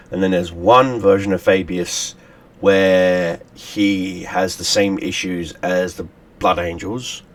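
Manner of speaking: usual speaking voice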